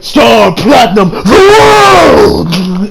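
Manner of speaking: drawn-out battle cry rising high and loud; hammed-up heroic shout; attack call. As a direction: bellow the move name like an anime hero. Calling out a signature attack.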